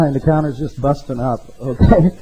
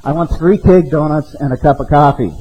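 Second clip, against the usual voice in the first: louder, pushing the order at the window; man at drive-through repeats his order, louder; louder retold order; 2 semitones higher, 6 dB louder than usual. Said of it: "So he says louder".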